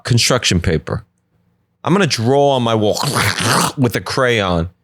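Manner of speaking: ordinary talk, nothing marked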